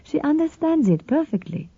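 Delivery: a calm, lightly voiced sister; Sophia's voice.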